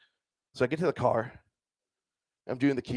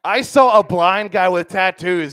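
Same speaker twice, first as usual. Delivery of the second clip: louder, slower emphatic repeat; 4 semitones higher, 8 dB louder, slower than usual. Shown as a demonstration. Comic repeats punchline louder to the audience. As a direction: punch it out big and slow for the crowd. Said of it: "repeated for emphasis".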